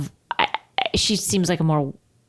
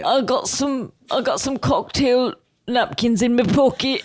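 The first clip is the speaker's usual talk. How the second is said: higher put-on mummy's boy voice; Max impression; 5 semitones higher than usual. Son showing Mummy his bar supplies, high put-on voice. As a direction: play an over-eager little helper. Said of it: "He's like".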